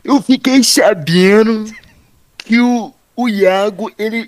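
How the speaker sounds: high, loud, slow gossip-host voice; Léo Dias impression. Doing Léo Dias for friends, high slow gossip voice. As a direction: pitch it up and drag it like a celebrity gossip reporter. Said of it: "Eu vou tentar imitar o, o Léo Dias".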